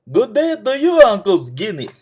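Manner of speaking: high, loud fool's hail; foolish greeting. A narrator's reading. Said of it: "in a way that only fools can".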